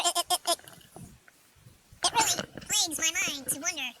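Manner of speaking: usual speaking voice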